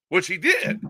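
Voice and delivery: usual speaking voice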